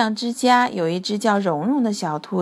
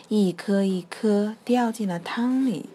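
ordinary delivery, nothing marked

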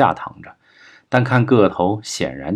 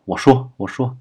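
higher-pitched plea for mercy; pleading; 4 semitones higher than usual. A narrator's reading. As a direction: beg hurriedly to be spared. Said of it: "立刻求饶道". (求饶)

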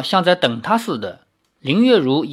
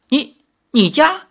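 high, louder incredulous echo; stammered disbelief; 11 semitones higher, 5 dB louder than usual. A narrator's reading. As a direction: repeat it in disbelief, pitch jumping up. Disbelieving reaction to her claim.

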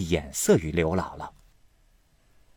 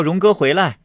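loud high call out the window; higher and louder summons after a departing nephew; 10 semitones higher, 8 dB louder than usual. A narrator's reading. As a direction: call him back across the courtyard. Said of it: "便向窗外叫".

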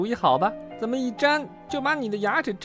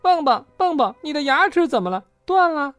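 very high, loud, panicky questions; high-voiced fretting; alarmed friend; 13 semitones higher, 5 dB louder than usual. A narrator's reading. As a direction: blurt it out in worried alarm. Alarmed friend rushing over.

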